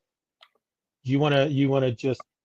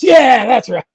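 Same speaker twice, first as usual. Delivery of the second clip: much higher and louder, mocking the fan; hammed-up fan voice; 11 semitones higher, 12 dB louder, slower than usual. Host mimics the comic fan's smug agreement, hammed-up. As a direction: parrot him like a smug know-it-all.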